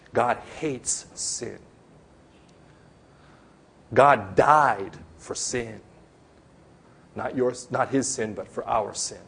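ordinary delivery

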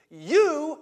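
sudden loud YOU shouted high; forceful shout. Retold or imitated. Jesus ordering his disciples, a sudden loud YOU. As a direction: slam the word you down on them.

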